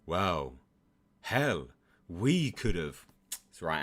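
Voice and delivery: ordinary talk, nothing marked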